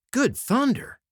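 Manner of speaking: sharp high outburst of surprise; startled exclamation. A narrator's reading. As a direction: blurt it out, caught off guard. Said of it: "they ejaculated".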